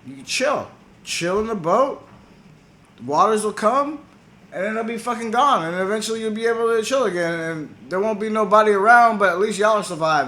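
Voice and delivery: high-pitched, acted-out instructions; coaching a friend. Enki explains flood survival plan.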